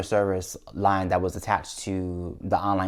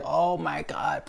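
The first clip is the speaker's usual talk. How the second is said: slow, breathless whispered oh my God; panicked whisper; 6 semitones higher, 6 dB quieter, much slower than usual. Retold or imitated. Owner panicking alone outside the vet, whispering. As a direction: let it slip out as a scared whisper. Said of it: "So I'm freaking out now".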